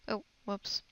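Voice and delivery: ordinary talk, nothing marked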